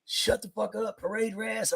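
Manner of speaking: high clipped mock command; imitating an officer shutting someone up; mock barked order. Officer quoted ordering silence.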